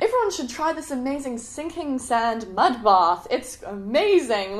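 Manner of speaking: oversell it with big stretched words; hammed-up enthusiasm. Selling the sinking mud bath to everyone, overdone excitement. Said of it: "I'm saying to everyone".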